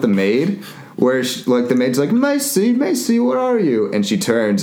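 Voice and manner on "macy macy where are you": put-on high sing-out call for a child. Maid searching the mansion for Macy, calling out high. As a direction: call out in a high mock maid's voice.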